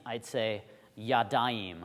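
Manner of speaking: "yadayim" said slowly, stretching the "ayim" ending; Hebrew dual ending. Shown as a demonstration. Teacher showing the class the Hebrew dual form. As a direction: draw out "yadayim" syllable by syllable. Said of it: "add this ayim sound".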